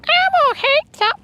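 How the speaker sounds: high squeaky puppet voice; Elmo voice. Pretend. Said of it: "See, I'm losing it".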